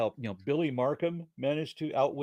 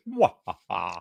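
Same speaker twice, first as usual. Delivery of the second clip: low cackle of a mustache-twirling villain; evil villain cackle; 6 semitones lower, 3 dB louder, slower than usual. Pretend. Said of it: "twirl my mu-, waxed mustache and say".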